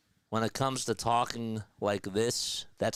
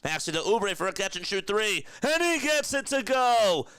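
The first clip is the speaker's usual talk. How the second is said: much higher, louder big-play call; excited announcer call; 11 semitones higher, 4 dB louder than usual. Acted. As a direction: sell the made three to the crowd. Big three made, timeout called.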